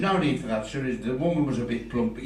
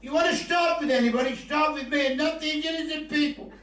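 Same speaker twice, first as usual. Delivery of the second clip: high defiant challenge; 12 semitones higher than usual. Retold or imitated. Challenging gunman in bank.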